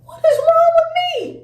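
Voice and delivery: high, loud exasperated cry; exasperated wail. Real, not acted. Storyteller berating herself for hyping up a rival, loud high wail. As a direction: wail it in disbelief at yourself.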